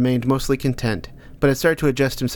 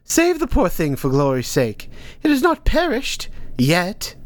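slower, lightly voiced old woman; stern old teacher; 6 semitones higher than usual. Hilda Dee urging Ank to save the hare, stern. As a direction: press him to act.

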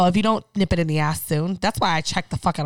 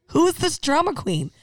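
"Who is this drama queen?" asked higher in mock disbelief; mock exasperated; 8 semitones higher than usual. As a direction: tease the dog with a higher mock question.

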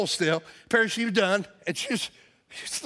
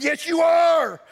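much higher, louder snap back; heated shout; 8 semitones higher, 5 dB louder, slower than usual. Retold or imitated. Pastor insisting she pick up trash, heated shout. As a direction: shut her down. Heated argument at gas pump.

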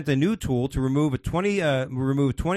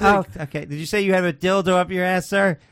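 high, raised public voice; loud desk clerk; 7 semitones higher, 5 dB louder than usual. Acted. ER clerk confirming a patient's secret aloud, loudly. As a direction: repeat it loudly for the whole room.

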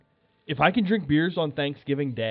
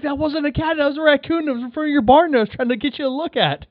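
flustered protest; 11 semitones higher, 4 dB louder than usual. Retold or imitated. Protesting to mom.

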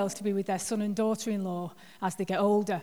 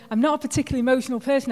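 rattled off fast and louder, leaning on not; fast insistent; 4 semitones higher, 6 dB louder, much faster than usual. Real, not acted. Pastor insisting to the church she is not emotional, fast and emphatic. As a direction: hurry it out, pressing hard on "not".

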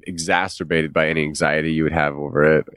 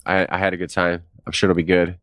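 ordinary talk, nothing marked

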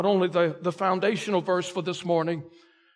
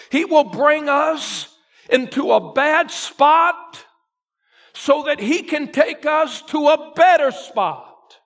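preaching at full force; 3 semitones higher, 4 dB louder than usual. Acted. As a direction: press the point home loudly. Preaching climax.

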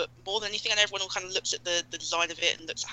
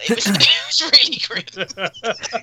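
laughing; 3 semitones higher, 8 dB louder, much slower than usual. Real, not acted. Laughing about feeling sick.

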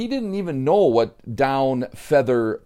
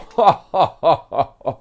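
loud, high belly laugh; hearty laugh; 6 semitones higher, 6 dB louder than usual. A narrator's reading. Sebastian laughs at Joey's "goose up", hearty laugh. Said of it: "Sebastian just laughed".

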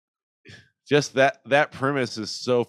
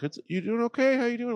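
lightly voiced, much higher caring server; a hint of a sassy mom, higher; 12 semitones higher than usual. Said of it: "kinda sassy".

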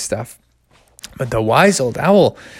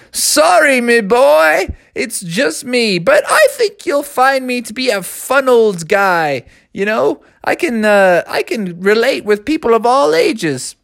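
put-on wise old owl; 9 semitones higher than usual. Old owl reassures the boy.

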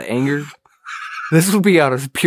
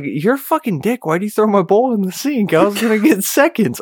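put-on girlfriend snapping back; 7 semitones higher than usual. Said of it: "that's when she flipped on me".

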